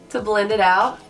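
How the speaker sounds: ordinary talk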